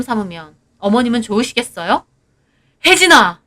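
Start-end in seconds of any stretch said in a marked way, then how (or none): 2.8-3.4 loud, high yell of her name; angry shout. Acted. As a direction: bark her name in anger. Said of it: "남편은 버럭 화내고". (버럭 화내며 이름 부르기)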